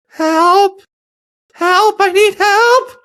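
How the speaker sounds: high, loud imitation of someone calling out; mock cry for help. Woman calling from a hotel room, imitated high cry for help. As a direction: call out in a high put-on distressed voice.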